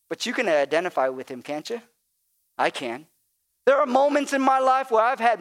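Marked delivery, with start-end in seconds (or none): none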